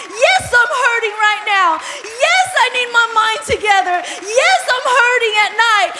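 loud rising cries of yes, I need; pleading cries. Acted. Congregation voiced crying out their needs, loud and pleading. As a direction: cry out each need like a desperate worshipper.

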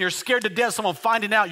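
ordinary talk, nothing marked

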